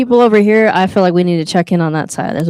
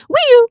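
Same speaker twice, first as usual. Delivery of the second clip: high wee-oo whistle. Real, not acted. Playful sound, very high.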